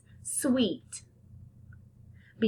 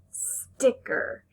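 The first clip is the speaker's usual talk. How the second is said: "Sticker" whispered, no voice; whispered word; 5 semitones higher than usual. Shown as a demonstration. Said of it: "Where do you hear that S blend".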